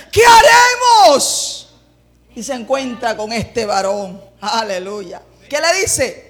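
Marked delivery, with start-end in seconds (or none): 0.1-1.7 long, high desperate shout; very loud, drawn-out alarmed question; exclaimed cry. Acted. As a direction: wail it loudly to your master. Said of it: "El hombre pega un grito".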